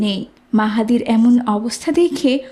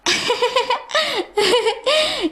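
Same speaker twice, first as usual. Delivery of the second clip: long high scornful laugh; mocking laugh; 12 semitones higher than usual. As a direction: laugh at the wrong answer. Teacher laughs at wrong answer.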